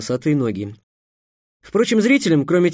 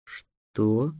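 hoarse, quiet disbelief; hoarse rasp; 6 semitones lower, 7 dB quieter than usual. Acted. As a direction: rasp it out low. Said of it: "просипел Гавар". (сиплый голос)

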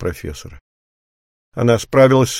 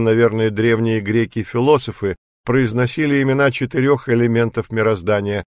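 plain reading voice

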